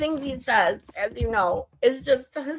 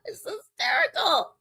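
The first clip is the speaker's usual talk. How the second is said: laughing hard through the words; high, helpless laughter after a joke; cracking up; 8 semitones higher than usual. Real, not acted. Cracks up after played rant.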